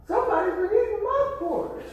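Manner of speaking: high squeaky little bear; storyteller's high cub voice. Acted. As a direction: pipe it up like a small cub. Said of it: "the little bear says".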